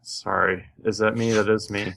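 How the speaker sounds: normal talking voice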